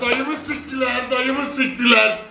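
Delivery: wailing slow madman act, laughing off; raving madman. Pretend. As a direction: ham up a raving lunatic. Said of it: "Deli taklidi yapacağım".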